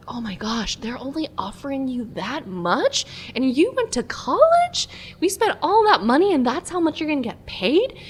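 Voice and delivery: high, rising mock disbelief; imitating incredulous parents. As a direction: act out parents aghast at the figure.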